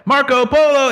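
high sing-song Marco Polo call; mock call-out. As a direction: call it out like the pool game. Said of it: "Or like".

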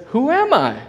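high, lifted question; Jesus asking. Retold or imitated. Jesus asks directly, the pitch lifting high. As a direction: let the question ring up high. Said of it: "He says".

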